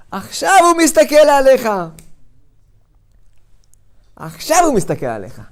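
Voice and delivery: ordinary talk, nothing marked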